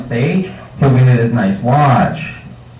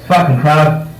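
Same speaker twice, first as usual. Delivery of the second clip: normal talking voice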